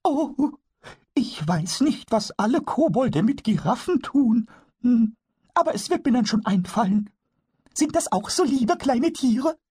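a tiny goblin voice, high and wondering; kobold voice. Acted. Pumuckl asks Eder about the colour, very high kobold voice.

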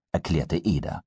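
ordinary delivery, nothing marked

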